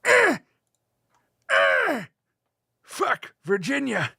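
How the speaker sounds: mock squeak; squeaky outburst. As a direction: squeal it out as a joke. Goofy high outburst.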